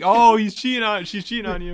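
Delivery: high, loud tattling call; mock alarmed report. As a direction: blurt it out as the detective raising the alarm. Acts out detective phoning the news.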